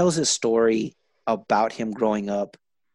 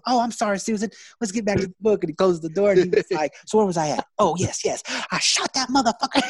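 much higher, louder camp teacher reading the gangster book through laughter; campy teacher voice; 9 semitones higher, 4 dB louder than usual. Retold or imitated. Teacher reading the gang book aloud, high and campy. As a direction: ham up the flamboyant teacher voice. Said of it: "the feminine flavor that he had".

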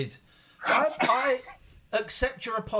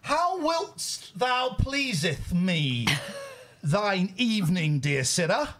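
high, slow, olde-worlde theatrical; Shakespearean voice; 6 semitones higher, 3 dB louder, much slower than usual. Pretend. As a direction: ham up a Shakespearean actor. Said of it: "this is Shakespeare all over again".

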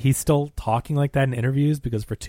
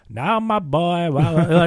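slow, higher-pitched mock movie accent; Tom Hanks's horrendous accent; 5 semitones higher, 4 dB louder, slower than usual. Co-host imitating what he heard in the theater, horrendous put-on accent. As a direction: drag it out in an overdone, odd accent. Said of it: "this sounds horrendous".

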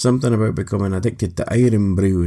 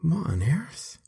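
startled whisper; 7 semitones higher, 8 dB quieter, slower than usual. Acted. Startled by monster noises.

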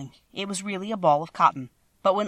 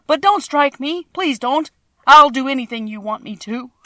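high, loud begging; frightened pleading; 8 semitones higher, 10 dB louder than usual. A narrator's reading. Little man begging the Tin Woodman not to strike, frightened. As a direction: plead for mercy in a raised voice. Cornered man begs for mercy.

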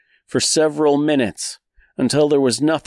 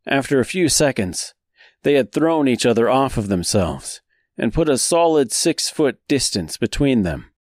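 ordinary delivery

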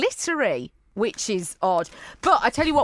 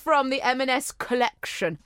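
ordinary talk

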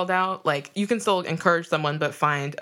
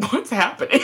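laughing disbelief; 6 semitones higher than usual. Retold or imitated. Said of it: "I was like".

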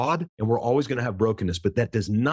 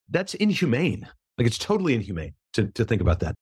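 ordinary talk, nothing marked

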